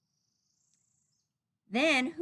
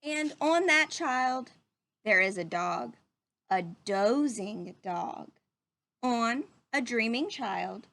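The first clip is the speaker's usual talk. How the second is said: put-on reading to children. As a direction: read it gently to little listeners. Reading picture book to children.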